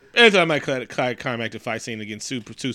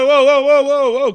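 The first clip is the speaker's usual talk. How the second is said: high, loud string of whoas; mock shocked protest; 10 semitones higher, 9 dB louder than usual. Viewer shocked by blood in Marvel, hammed-up alarm. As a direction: call a halt in comic alarm.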